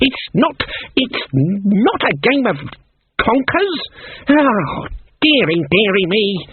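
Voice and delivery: high, slow tut-tutting crone; scornful old witch. Acted. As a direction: tut at the girls with theatrical pity. Granny scolds the girls.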